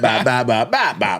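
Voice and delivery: "bop" five times in a bouncing beat; rhythmic run of identical "bop" sounds; repeated cadence. Shown as a demonstration. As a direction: pulse each "bop" evenly, like a sound effect. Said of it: "The cadence".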